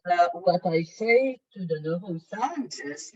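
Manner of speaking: usual speaking voice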